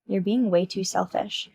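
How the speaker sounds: normal talking voice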